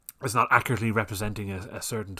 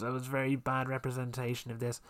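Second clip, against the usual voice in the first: soft, put-on whiny complainer; mock offended complainer; 2 semitones higher, 7 dB quieter than usual. Mocking one upset viewer's complaint in a put-on voice. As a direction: parody a lone offended viewer, softly. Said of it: "one person goes".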